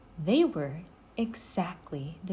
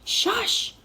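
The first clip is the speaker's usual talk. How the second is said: sharp, higher hushing; urgent shush; 4 dB louder than usual. A narrator's reading. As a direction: Jack hushes Annie so they aren't heard, urgent shush. Jack hushing Annie.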